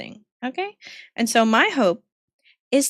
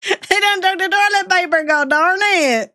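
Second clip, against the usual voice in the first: higher, put-on Southern complaint; Southern accent; 5 semitones higher than usual. Streamer in a Southern accent griping about toilet paper. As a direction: huff like an old farmer. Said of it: "The accent's back".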